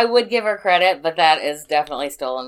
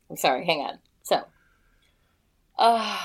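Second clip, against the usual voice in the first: ordinary talk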